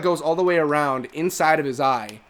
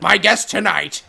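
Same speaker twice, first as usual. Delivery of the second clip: slow, higher, louder cartoon vulture voice; Larry King; 4 semitones higher, 5 dB louder, slower than usual. Host imitating South Park's Larry King as a vulture, slow squawk. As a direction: announce it as a squawking caricature talk host. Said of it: "the way that South Park portrayed him".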